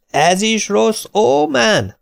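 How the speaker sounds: high, slow shout over the blaring cassette; shouting over music. Retold or imitated. Shouting into Gyarmati's ear over the Bee Gees, slow and high. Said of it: "ordítottam Gyarmati fülébe".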